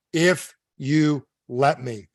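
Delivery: very slow, separated words; deliberate plumber. Acted. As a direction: let each word land on its own. Plumber makes his condition clear.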